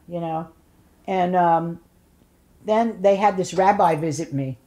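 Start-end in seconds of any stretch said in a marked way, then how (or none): none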